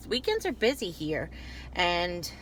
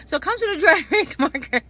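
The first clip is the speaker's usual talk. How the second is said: laughing; 8 semitones higher, 7 dB louder, faster than usual. Real, not acted. Laughing at her own mistake.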